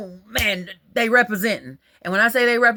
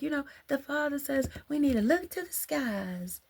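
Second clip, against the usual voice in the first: put-on quoting the girl; 6 semitones higher, 8 dB quieter than usual. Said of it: "the girl was like".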